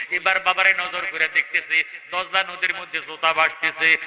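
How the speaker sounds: ordinary delivery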